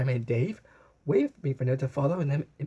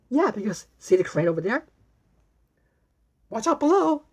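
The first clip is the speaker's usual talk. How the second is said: higher, louder call of watch out; bellowed warning; 9 semitones higher, 5 dB louder than usual. A narrator's reading. Blue playing crane operator, calling out loud and high. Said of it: "bellowed Blue".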